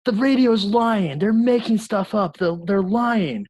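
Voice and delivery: high, loud, drawn-out yell; re-enacted childhood yelling. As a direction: yell it out like an outraged four-year-old. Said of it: "I start just yelling".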